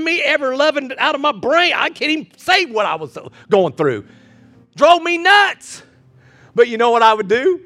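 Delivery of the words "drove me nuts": much higher, slow and drawn out; exasperated. Acted. Pastor recalling covid stress, slow high exasperation. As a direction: stretch it out in exasperation.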